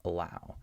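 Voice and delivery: "allow" with a very quick, unstressed first vowel; reduced schwa. Shown as a demonstration. Teacher goes as fast as possible over the syllable, schwa in "allow". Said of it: "that's what creates the schwa sound".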